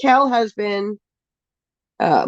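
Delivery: normal talking voice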